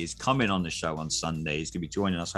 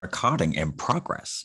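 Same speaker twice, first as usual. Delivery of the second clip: slow put-on American announcement; American accent. Shown as a demonstration. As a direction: do an automated notice in an American accent. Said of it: "my American accent".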